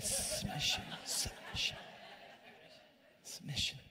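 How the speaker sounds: whispered like a forbidden word; mock whisper. Pastor teasing the crowd, whispering the dreaded word. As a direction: breathe it out as if it's a cuss word. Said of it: "can you say it? some of you can't even say it".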